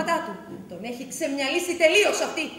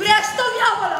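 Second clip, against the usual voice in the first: angry; 5 semitones higher, 6 dB louder than usual. Acted. Wife loses her temper.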